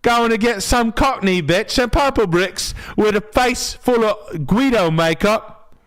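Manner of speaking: high, fast hammed-up Cockney accent. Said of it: "Cockney accent".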